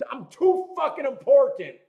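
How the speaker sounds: high, loud, laughing mock self-importance; mock diva. Laughing at his own diva act to his stream. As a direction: ham up the diva bit, laughing. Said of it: "and I'm a diva".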